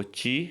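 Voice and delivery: چی said slowly and clearly, Italian C; C pronounced چی the Italian way; Italian letter name. Shown as a demonstration. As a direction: drill چی with a ch sound. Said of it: "سی کو".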